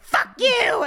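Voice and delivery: high, loud acted curse; mock angry outburst. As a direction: yell it as in a couple's fight. Acts out early fights with husband.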